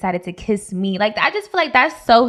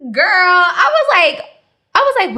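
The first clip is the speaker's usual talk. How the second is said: high laughing-crying shock, much louder; shocked disbelief; 11 semitones higher, 7 dB louder than usual. Real, not acted. Telling her friend the shock, high laughing cry. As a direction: voice cracking up in disbelief.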